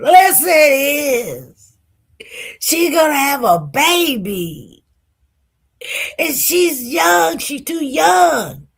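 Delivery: high, slow wail of protest; acted crying. Crying mother insisting to her date it is disastrous, wailing. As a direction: wail each word out, imitating tearful despair. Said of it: "just cried, cried, cried".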